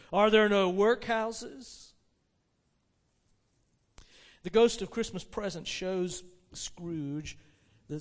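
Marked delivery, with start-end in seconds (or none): 0.1-1.9 slow, loud accusing question; throwing words back. Acted. The Spirit turns Scrooge's words on him, accusing. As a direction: hurl his own words back, drawn out. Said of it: "turning on him for the last time with his own words".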